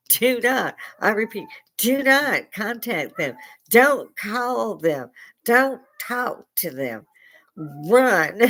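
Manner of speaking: high, slow, drawn-out commands; emphatic warning. Real, not acted. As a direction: hammer each warning word by word. Mock-urgent warning to adoptees.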